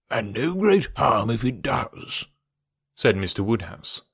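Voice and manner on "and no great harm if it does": put-on slow, unbothered old gentleman; placid old father. Mister Woodhouse dismissing Weston's objection, slow and placid. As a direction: answer slowly, in mild disagreement.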